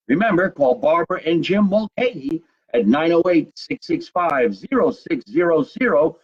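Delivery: hammed-up announcer plug; sponsor read. Host thanks the show's sponsor, hammed-up announcer read. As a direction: sell it like a live ad, stretching out the numbers.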